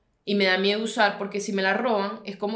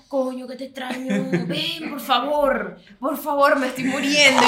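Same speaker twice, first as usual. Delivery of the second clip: mock pleading; 3 semitones higher, slower than usual. Quoting lonely coworker begging.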